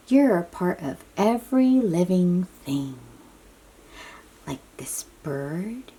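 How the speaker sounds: ordinary delivery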